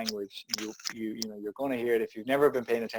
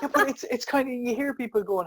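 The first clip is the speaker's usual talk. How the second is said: incredulous; 11 semitones higher than usual. Real, not acted. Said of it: "pitch leaps up incredulous".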